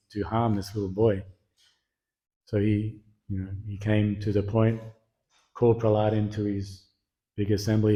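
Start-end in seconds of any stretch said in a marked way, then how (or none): none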